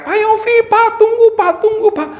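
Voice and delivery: high, loud call to stop someone. Retold or imitated. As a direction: shout after the pastor down the street. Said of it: "teriak-teriak". (teriakan tinggi memanggil dari jauh)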